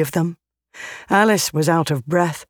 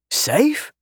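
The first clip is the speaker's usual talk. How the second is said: incredulous; 9 semitones higher than usual. Acted. Berger echoes Rayna's word in disbelief.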